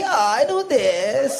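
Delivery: slow, drawn-out fake posh English; mock posh accent. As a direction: stretch it out like the viral reel. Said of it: "आपने वो Insta पे reel देखी".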